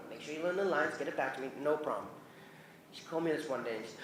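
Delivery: ordinary talk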